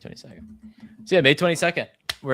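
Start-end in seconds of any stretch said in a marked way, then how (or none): 0.0-0.6 breathy whispered repeat. Shown as a demonstration. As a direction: whisper the date under your breath. Repeating the release date.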